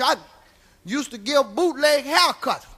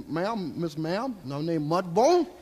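slow, low and soft, laying on the manners; tipping his hat to a big woman; polite slow greeting; 7 semitones lower, 6 dB quieter than usual. Retold or imitated. Mock courtly introduction to the lady at the depot. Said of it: "introduced myself".

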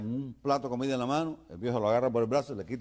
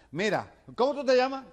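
high, loud demand at the foreigner; shouting; 6 dB louder than usual. Acted. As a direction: bellow it as if he were hard of hearing. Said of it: "le sigue gritando".

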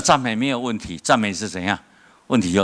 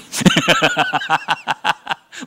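high rising laugh; 10 semitones higher, 4 dB louder than usual. Real, not acted. Preacher laughs at his story.

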